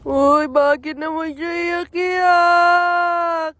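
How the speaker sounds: slow, loud singsong coo; loud drawn-out cooing. Real, not acted. Asking the crying little one why, loud drawn-out coo. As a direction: stretch it out loudly to comfort a crier.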